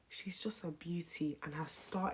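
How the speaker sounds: hushed, breathless admiration; whisper. Real, not acted. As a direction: whisper it like a secret compliment. Gushing about [name], hushed.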